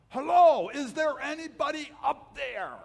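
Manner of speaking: high, loud cry upward from the cliff, slowed; desperate yell. Acted. Falling climber yelling up the mountain for help, high and loud. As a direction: call out for help from far below. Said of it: "So he yells up. He says".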